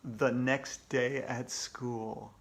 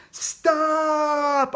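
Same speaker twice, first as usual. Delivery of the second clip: high, loud scream; desperate shout; 12 semitones higher, 8 dB louder than usual. Acted. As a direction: yell it to halt the wedding. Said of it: "I screamed".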